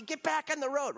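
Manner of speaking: high-pitched mock-angry order; exasperated scolding. Balaam whacking the donkey back onto the road, mock scolding. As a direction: act out losing patience with the animal.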